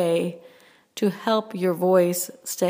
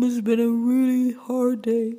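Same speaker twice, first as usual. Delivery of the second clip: wailing, moaned words; 2 semitones higher than usual. Shown as a demonstration. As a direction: moan it out like a ghost. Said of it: "Okay, the moan".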